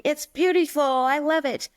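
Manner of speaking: high-pitched, enthusiastic reassurance; gushing praise. Retold or imitated. Teacher reassures anxious students about their projects, gushing. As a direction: gush like an encouraging teacher.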